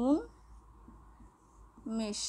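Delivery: উন্মেষ breathed out slowly, no voice; whispered slow demo. Shown as a demonstration. Teacher showing students উন্মেষ in a slow whisper. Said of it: "দেখো".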